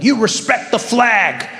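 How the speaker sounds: slow, stern patriot lecture. Retold or imitated. Patriotic person lecturing about the flag, slow and stern. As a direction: scold them like a flag-waver. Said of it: "people who are like".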